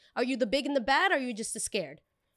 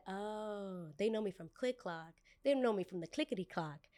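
muttered aside; 8 dB quieter than usual. Retold or imitated. Mocking customer's friends, muttered aside.

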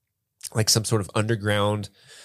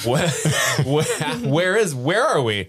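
mock confusion; 6 semitones higher, 4 dB louder than usual. Mimicking confused Lost character.

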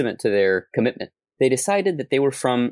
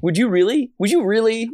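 incredulous; 10 semitones higher than usual. Real, not acted. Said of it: "disbelieving question".

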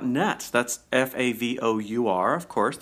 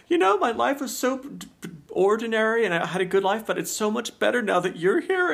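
lightly voiced quote; 10 semitones higher than usual. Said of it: "how she said".

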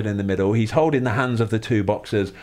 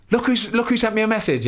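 higher-pitched, buzzing retelling; retold buzzing excitement; 9 semitones higher than usual. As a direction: relive showing off the message to your son. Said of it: "I was buzzing so much".

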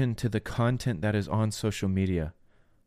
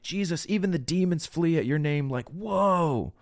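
higher-pitched, imitated amazement; mock marveling; 6 semitones higher than usual. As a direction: play awestruck disciples reporting back, pitched up. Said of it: "they come back and they're marveling".

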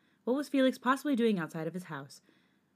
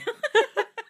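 high burst of laughter; 10 dB louder than usual. Real, not acted. Both cracking up.

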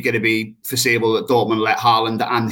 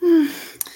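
big heavy sigh, high and long; dramatic sigh. Real, not acted. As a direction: let out a huge weary sigh. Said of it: "That was quite a big sigh then".

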